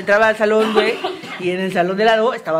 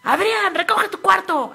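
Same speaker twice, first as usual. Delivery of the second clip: put-on high childish call to the brother; a high imitated shout; imitating a child's yell; 11 semitones higher, slower than usual. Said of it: "el niño de repente gritaba".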